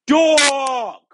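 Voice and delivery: loud exasperated yell; real outburst shout. Real, not acted. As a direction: blurt it out in frustration. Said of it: "I almost did it".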